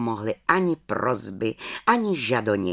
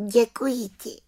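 grateful little witch; 10 semitones higher than usual. Acted. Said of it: "řekla malá čarodějnice".

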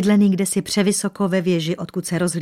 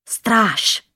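loud, higher call through a hiss; hissed command; 4 semitones higher, 5 dB louder than usual. A narrator's reading. Pale king calls his guard after the shot, hissed command. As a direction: summon the guard sharply, shaken. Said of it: "sykl bledý král".

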